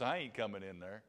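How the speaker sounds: low, quiet refusal as the possum; storyteller's subdued possum voice; stubborn possum. Acted. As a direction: refuse quietly, unmoved by the coaxing.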